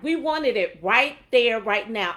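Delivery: ordinary delivery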